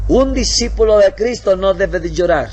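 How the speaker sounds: loud, high scolding; stern rebuke. Acted. Missionary scolds the crying brother, loud stern rebuke. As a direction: slam the rule down on him.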